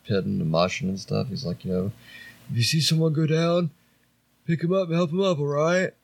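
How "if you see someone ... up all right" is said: imitating a mild-mannered singer in a higher voice; gently high-pitched mock stage banter; mellow frontman banter. Singer to the moshing crowd, mock-mellow and high. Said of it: "he seems like the mellowest dude".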